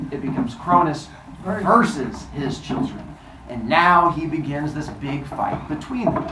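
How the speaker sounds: ordinary delivery, nothing marked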